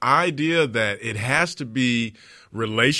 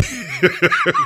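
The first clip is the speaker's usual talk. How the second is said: a real laugh that shoots way up; high burst of laughter; 5 dB louder than usual. Real, not acted. Laughs at his long digression.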